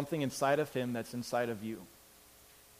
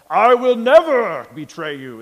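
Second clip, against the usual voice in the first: slow, loud, lifted heroic vow; higher and much louder grand pledge; superhuman voice; 7 semitones higher, 15 dB louder, slower than usual. Retold or imitated. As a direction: proclaim it like a hero swearing an oath. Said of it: "he stands up with this superhuman voice".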